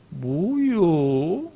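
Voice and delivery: extremely drawn-out Chungcheong question; slow Chungcheong drawl, rising at the end; Chungcheong dialect. Shown as a demonstration. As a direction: drag the vowels like a slow Chungcheong speaker. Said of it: "충청도 말 한마디요".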